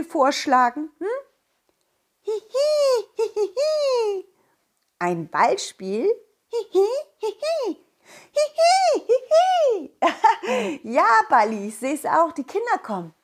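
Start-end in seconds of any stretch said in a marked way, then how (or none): 2.2-4.2 high squeaky giggle; giggly sneering imp. Acted. Ball character giggles. 6.5-10.8 high squeaky giggle; giggly sneering imp. Acted. Ball character giggles.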